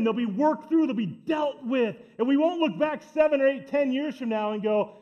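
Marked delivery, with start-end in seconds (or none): none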